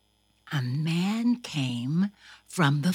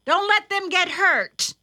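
mother calling out; 10 semitones higher, 4 dB louder than usual. A narrator's reading. Mother calls instructions to Thumper.

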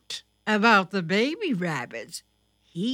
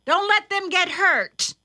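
high, louder motherly warning; warning mother rabbit; 11 semitones higher, 6 dB louder than usual. Acted. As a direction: press the warning on your child. Mother warns Thumper.

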